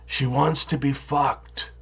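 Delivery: low, slow hushed aside; whispered. A narrator's reading. Exasperated cop to deaf farmer, slow whisper. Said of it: "leans in and whispers".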